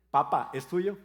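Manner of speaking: voz de niña más fuerte y aguda; llamado infantil fuerte. Retold or imitated. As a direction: llamar a Papa como niña ansiosa. Nieta llama al abuelo. (la nieta llama al abuelo, fuerte y aguda)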